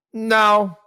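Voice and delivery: ordinary delivery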